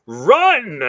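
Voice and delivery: very high, loud yell; urgent shout. Acted. Relg repeating his warning to flee, loud. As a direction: scream it again. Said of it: "Relg repeated".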